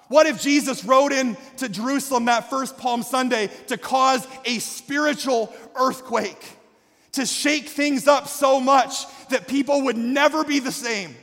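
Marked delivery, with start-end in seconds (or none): none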